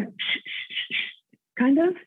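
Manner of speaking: hushed repeated "sh" scrapes; imitated shuffling footsteps. Shown as a demonstration. Guest showing the host the shuffle heard at night, imitating it. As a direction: make separate dragging-foot noises with "sh". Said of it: "this weird shuffle, like a footstep shuffle".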